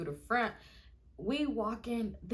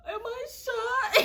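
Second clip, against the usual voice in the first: high, slow mock-crying wail; imitated tearful panic; 5 dB louder, much slower than usual. Drunk girl asking if she's shot, high mock crying. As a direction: wail it slowly through pretend tears. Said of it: "And I'm crying".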